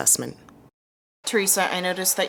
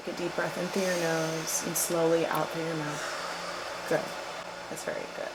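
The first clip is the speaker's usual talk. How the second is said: calm coaching; 6 dB quieter than usual. Acted. Coaching the patient's breathing.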